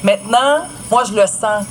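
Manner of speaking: ordinary delivery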